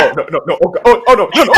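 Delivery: high, laughing oh no no no; imitating the player's panic; lightly hammed-up horror; mock panic. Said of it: "You're like".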